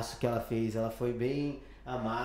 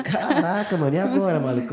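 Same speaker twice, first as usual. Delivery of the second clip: mock shock; 4 semitones higher, 9 dB louder than usual. Retold shock at the news.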